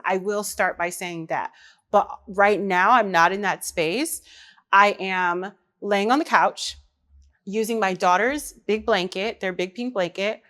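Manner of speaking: usual speaking voice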